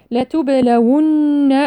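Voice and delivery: slow chanted لتبلون with a long-held nn; bounced ba, rounded waw. Shown as a demonstration. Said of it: "نقلقل الباء ونضم الواو". (ترتيل لتبلون بقلقلة الباء وضم الواو والغنة)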